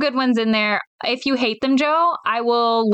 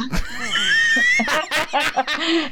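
laughing shout; 6 semitones higher than usual. Real, not acted. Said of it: "Damn, Yessie!".

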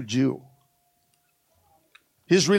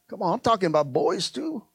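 ordinary talk, nothing marked

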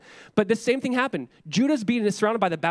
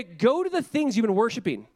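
normal talking voice

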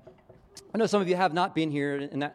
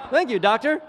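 higher, louder thanks through a laugh; awkwardly amused thank you, laughing; 9 semitones higher, 9 dB louder than usual. Retold or imitated. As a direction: thank him while cracking up. Awkward thanks after joke.

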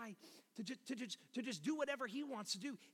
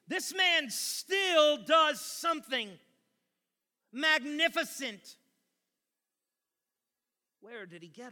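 loud, emphatic pulpit delivery; impassioned sermon at full force; forceful preaching; 3 semitones higher, 11 dB louder than usual. Acted. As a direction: drive the argument home, stretching key words.

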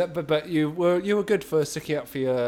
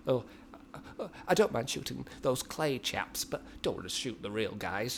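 imitated sweetness; 8 dB quieter than usual. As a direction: gently quote a tender-hearted friend. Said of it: "Mark said very sweetly".

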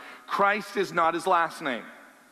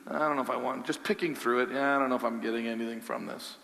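mock bored mumble; 5 semitones lower, 5 dB quieter, faster than usual. Mimics bored picky listener.

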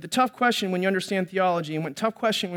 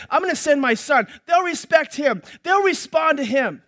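higher, louder hopeful resolve; father's hope; 6 semitones higher, 5 dB louder than usual. Acted. Father deciding to send his son, raised hopeful voice. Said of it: "the father says".

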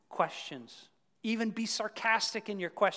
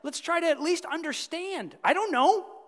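much higher and louder, eager and inviting; eager Philip; 12 semitones higher, 8 dB louder than usual. Retold or imitated. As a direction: pull your friend along with excitement. Philip answers Nathanael's doubt.

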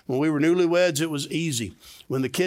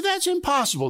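incredulous high squeak; high mock protest; 14 semitones higher than usual. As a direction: pitch shoots up in disbelief. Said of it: "You say".

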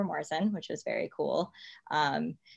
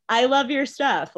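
higher, louder, slowed quote of admiring praise; thrilled praise; 9 semitones higher, 7 dB louder than usual. Retold or imitated. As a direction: savour each word as if hearing it again. Admired star praising her.

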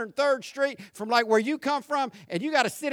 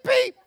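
high little beep; a jump way up for a polite horn; mock beep, much higher; imitated horn beep.

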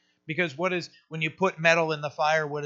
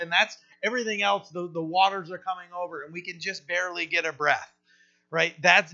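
normal talking voice

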